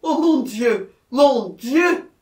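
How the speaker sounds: high, loud wail of terror; terrified cry. A narrator's reading. Jacquemin prey to the most frightful terror, crying out. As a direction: cry out in utter dread. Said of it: "en proie à la plus effroyable terreur".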